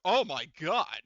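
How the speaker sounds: loud, delighted outburst; real excited yell; excited shout. Real, not acted. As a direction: burst out with relief and joy. GM reacts to lucky armor roll.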